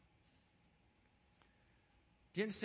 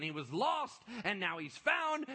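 proclaimed climax; 7 semitones higher, 7 dB louder, slower than usual. Acted. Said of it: "read as scripture climax".

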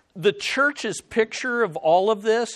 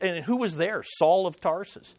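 usual speaking voice

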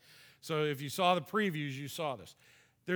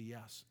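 ordinary talk, nothing marked

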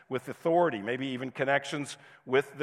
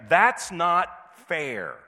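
put-on drawn-out, louder childish whine of protest; whiny toddler; 4 semitones higher, 6 dB louder, much slower than usual. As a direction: whine it slowly like a toddler losing a toy. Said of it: "you will find them saying".

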